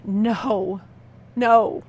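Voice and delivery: a mild mock cry of refusal; inner scream. She replays her inner voice screaming no. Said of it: "Everything inside of me is screaming".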